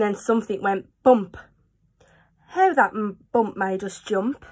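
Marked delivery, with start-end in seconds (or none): none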